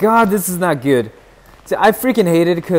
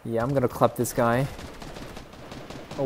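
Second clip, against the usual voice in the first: ordinary delivery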